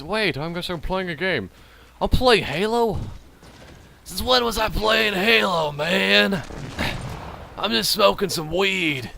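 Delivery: high, drawn-out stoner drawl. Acted. Voicing a confused stoned Halo player, put-on stoner voice. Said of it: "I cannot do the stoner voice today".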